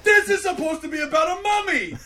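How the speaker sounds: high, loud incredulous shout through laughter; laughing mock outrage. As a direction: yell at the screen half laughing. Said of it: "And I'm like".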